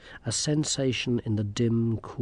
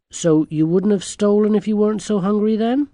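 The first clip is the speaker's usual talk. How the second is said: put-on higher, louder girl's voice, rising; questioning girl; 9 semitones higher, 8 dB louder than usual. A narrator's reading. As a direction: challenge them, lifting at the end. Girl questions the little men.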